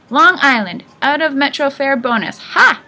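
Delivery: put-on loud, high old-woman cackling voice; brash old lady driver, ending on a sharp high "Ha!"; old cabbie woman. A narrator's reading.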